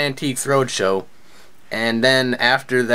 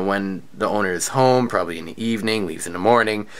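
ordinary talk, nothing marked

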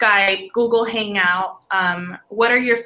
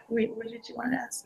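quiet aside; 13 dB quieter, faster than usual. Real, not acted. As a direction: ask your partner quickly under your breath. Hurried aside to co-interviewer.

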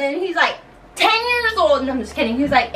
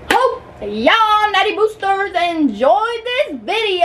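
normal talking voice

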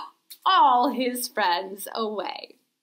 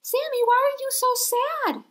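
high goat voice; 11 semitones higher, faster than usual. Acted. Nanny Goat asks.

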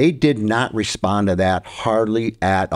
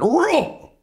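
a high roar, imitating a buck call; sudden mock buck roar from the tree stand; imitated buck roar. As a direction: belt out a roar like a rutting buck. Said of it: "all of a sudden I went".

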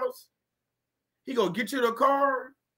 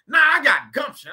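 shout of gumption; 5 semitones higher, 6 dB louder than usual. Acted. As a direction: belt it out like a preacher. Preaching shout.

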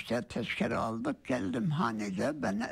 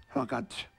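ordinary talk

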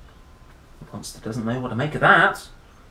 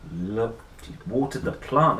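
ordinary delivery, nothing marked

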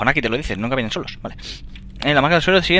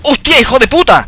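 loud high cry of shock; startled curse; 11 semitones higher, 12 dB louder than usual. Real, not acted. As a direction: blurt it in a fright. Susto en el juego. (taco a gritos de susto)

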